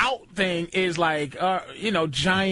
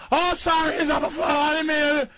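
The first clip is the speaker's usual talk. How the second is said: a lightly hammed panicked apology; mock flustered apology; 7 semitones higher, faster than usual. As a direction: play a flustered guy caught out. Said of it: "it wasn't like".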